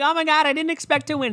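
high-pitched fake astonishment; hammed-up acceptance-speech shock; mock surprised award winner. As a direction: gush in a put-on high voice. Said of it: "they come up like".

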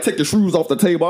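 high, teasing imitation of a boyfriend; imitating boyfriend. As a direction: mimic a partner's accented order. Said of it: "my boyfriend would be like".

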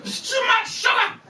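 very high, mock officious cry; government decree. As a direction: imitate a scandalised government. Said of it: "Scottish government got involved and they said".

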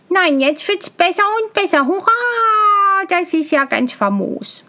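high, gleeful cheer; delighted simpleton. A narrator's reading. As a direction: burst into a long hurrah over your find. Tölpelhans delighted by find.

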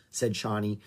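plain reading voice

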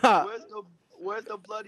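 high-pitched hammed-up British accent; mock British accent. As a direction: put on a comically bad British accent. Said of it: "I wanna hear your British accent".

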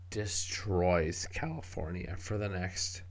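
ordinary talk, nothing marked

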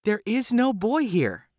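high, innocent denial; wife covering for Jack; wife denying. A narrator's reading. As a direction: brush him off lightly. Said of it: "The wife again said".

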